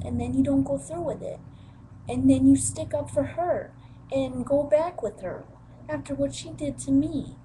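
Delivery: put-on hurling old grievances, loud; angry teen yelling at her father; retold angry outburst. Retold accusations at dad, loud.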